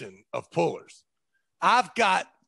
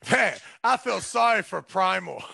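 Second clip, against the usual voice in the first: normal talking voice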